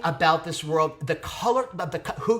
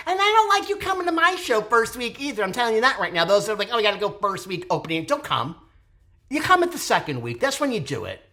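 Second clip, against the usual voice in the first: mock scolding; 9 semitones higher, 5 dB louder than usual. Real, not acted. Scolding audiences about opening week.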